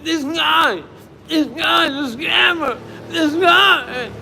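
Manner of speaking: high, loud mocking shout of a hater; mock yell. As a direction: yell it like an angry troll in the comments. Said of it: "mocking hostile commenters".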